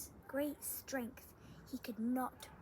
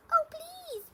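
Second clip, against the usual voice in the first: high squeaky mouse plea; 10 dB louder than usual. Acted. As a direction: squeak it out, tiny and desperate. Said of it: "squeaked Mouse".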